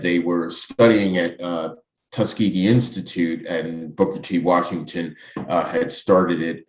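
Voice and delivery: ordinary delivery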